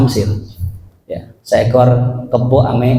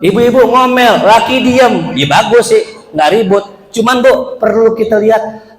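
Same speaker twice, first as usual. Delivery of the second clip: animated preaching; 7 semitones higher than usual. Acted. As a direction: rouse the mothers. Preacher addressing wives.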